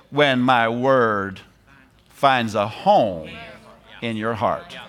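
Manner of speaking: slow, savoring each phrase; slow and lingering. Shown as a demonstration. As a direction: draw it out slowly, letting it sink in. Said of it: "I like the way he said that".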